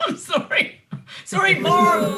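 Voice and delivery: high, loud, breaking into real laughter; laughing apology. Real, not acted. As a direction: apologize through a fit of laughter. Apologizing while laughing hard.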